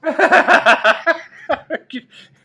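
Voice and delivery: high, loud burst of laughter. Real, not acted. Reader retelling an Irish comedian's joke, loud high laugh. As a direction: crack up loudly.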